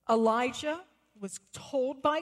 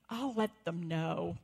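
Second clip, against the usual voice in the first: slow, lower, measured parental reply; humouring a child; 5 semitones lower than usual. Retold or imitated. Parent answering the proud little boy, humouring him. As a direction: lower the pitch and slow down to placate.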